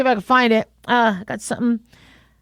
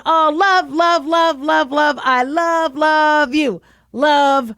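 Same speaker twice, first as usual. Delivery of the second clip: higher, playful gushing love; over-the-top affectionate babble; 4 semitones higher, slower than usual. Shown as a demonstration. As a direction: pile on the love, singsong and lavish. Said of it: "that's a little different than".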